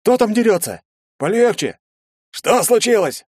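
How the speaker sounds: high, loud alarmed shout; тревожный окрик. A narrator's reading. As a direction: call out down the line in alarm. Окрики после толчка в колонне. (громкий тревожный окрик)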